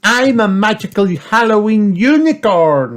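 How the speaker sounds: very high, loud piglet voice; Peppa. A narrator's reading. Peppa reveals a unicorn costume, high loud excited voice. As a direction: announce it as a thrilled child. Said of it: "said Peppa".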